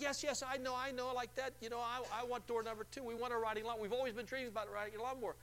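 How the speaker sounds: high, breathless yes yes yes; eager caller. Retold or imitated. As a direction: rush it like a thrilled winner. Acted excited caller.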